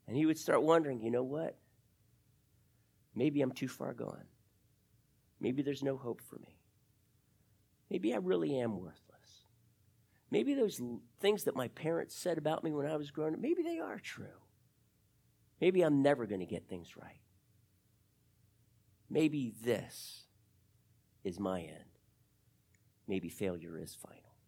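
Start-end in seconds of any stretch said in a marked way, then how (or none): 1.0-22.0 quiet despairing thoughts. Retold or imitated. Judas's despairing inner thoughts voiced. 23.1-24.3 whispered, low and hopeless; a whisper of giving up; despairing whisper. Retold or imitated. Judas's despair ends in whisper.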